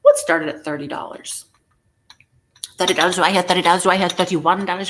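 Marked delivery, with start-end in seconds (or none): 2.8-3.1 louder, brisk auctioneer calling out bids; playful mock auction chant. 3.1-3.8 louder called-out bid to the room; playful auctioneer cry. Pretend. Loud auctioneer call, repeated later. 3.8-4.9 louder, brisk auctioneer calling out bids; playful mock auction chant.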